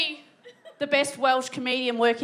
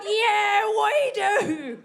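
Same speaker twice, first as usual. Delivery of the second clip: crowd cheering back; 5 semitones higher, much slower than usual. Retold or imitated. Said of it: "They're all like".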